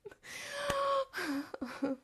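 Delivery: long squealing giggle; high giggle. Real, not acted. Laughing after mocking her own warning, high squealing giggle.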